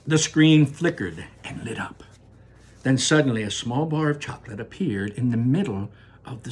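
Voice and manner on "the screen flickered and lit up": breathy suspenseful telling; whispered narration. A narrator's reading. Narrator builds suspense for listeners, whispered. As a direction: hush for the reveal.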